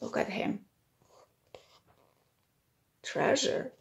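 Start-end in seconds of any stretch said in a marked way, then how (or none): 0.0-0.7 hushed whisper, no voice. Real, not acted. Reader whispering to the child about George. Whispered aside while reading.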